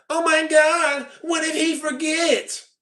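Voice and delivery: high, slow, whimpering mockery of a crying viewer; mock whiny crybaby. Mocking a crying chat viewer, slow high whiny voice. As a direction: whine it out like a big baby, dragging each word. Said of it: "Just crying".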